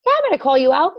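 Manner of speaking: ordinary talk, nothing marked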